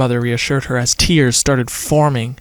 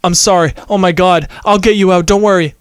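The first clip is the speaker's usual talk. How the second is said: louder, higher panicked reassurance; frantic shout; 5 semitones higher, 5 dB louder than usual. A narrator's reading. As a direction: shout to her parents in a rush of fear. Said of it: "Autumn screamed".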